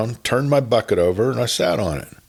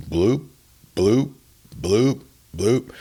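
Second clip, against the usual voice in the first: imitated water bloop; 2 semitones higher, slower than usual. A narrator's reading. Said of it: "we heard it again".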